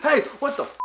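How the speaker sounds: high, sharp indignant yelp; retelling the soaking with a touch of outrage; mock pissed outburst. After being drenched with ice water, high indignant outburst. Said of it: "I was pissed".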